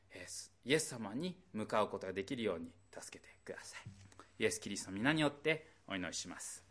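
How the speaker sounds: prayer. Real, not acted. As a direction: pray humbly to the Father. Closing prayer.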